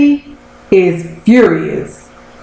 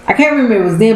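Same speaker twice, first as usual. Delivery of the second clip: ordinary talk, nothing marked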